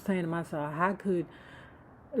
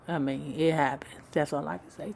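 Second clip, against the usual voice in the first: usual speaking voice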